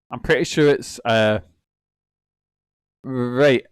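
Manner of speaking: usual speaking voice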